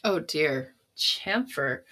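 normal talking voice

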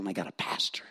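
a hushed aside to the pews; softly whispered, amused. Real, not acted. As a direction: whisper it like a confidence.

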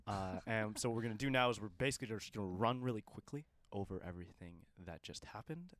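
ordinary delivery

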